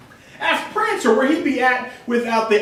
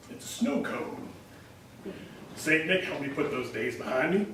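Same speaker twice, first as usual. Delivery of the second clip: ordinary delivery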